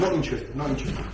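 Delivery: low, soft not interested; calm, quiet refusal. Shown as a demonstration. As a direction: say it softly, no heat. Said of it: "stay away from him".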